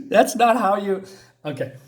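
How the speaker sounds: ordinary delivery, nothing marked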